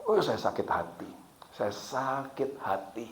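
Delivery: hushed retelling of heartache; recalled hurt. Retold or imitated. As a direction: dwell quietly on an old hurt. Preacher recalls being deeply hurt.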